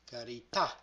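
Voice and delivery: "Carità" glides along, then stops sharp on the final "tà"; final stress demo. Shown as a demonstration. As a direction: slide through "Carità" and cut off on "tà". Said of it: "Here is an example".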